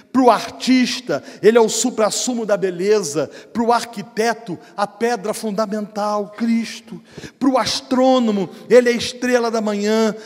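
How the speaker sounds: loud rhythmic pulpit litany, building in pitch; fervent preaching. Real, not acted. Preacher exalts Christ for every trade, fervent and loud. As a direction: proclaim each title forcefully, mounting steadily.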